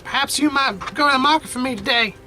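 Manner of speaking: put-on high, elderly grandmother voice; lilting old granny voice; Gran's voice. Voicing Gran in the game.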